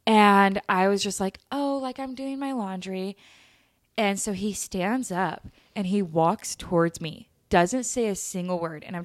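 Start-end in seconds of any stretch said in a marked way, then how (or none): 1.5-3.1 high, soft innocent answer; mock-timid, pitched up and hushed; retold meek reply. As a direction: answer small and high, imitating your nervous self. Retold nervous reply to creepy dad.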